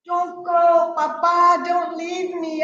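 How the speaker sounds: put-on high pleading cry of a little girl; crying child. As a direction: beg him not to leave, tearful. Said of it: "I cry and I say".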